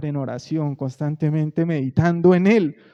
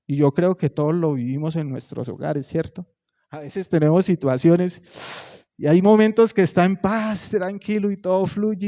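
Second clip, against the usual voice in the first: normal talking voice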